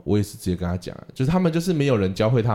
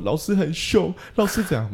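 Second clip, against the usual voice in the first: put-on whiny child; 9 semitones higher than usual. Child complaining to parents.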